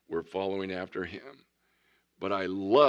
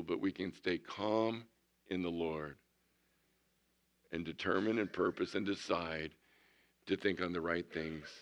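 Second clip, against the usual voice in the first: soft calm assurance; 4 semitones lower, 8 dB quieter than usual. Real, not acted. Preacher softens on staying calm.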